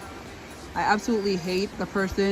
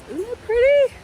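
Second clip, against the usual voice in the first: high delighted squeal; excited squeal; 14 semitones higher, 6 dB louder than usual. Real, not acted. Delighted at pretty flowers.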